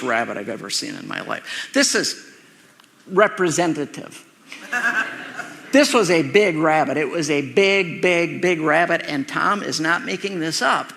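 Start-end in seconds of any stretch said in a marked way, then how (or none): 1.7-9.1 laughing. Real, not acted. Laughing about huge rabbit.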